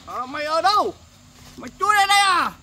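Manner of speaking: angry yelling. Acted. Father storms in demanding child.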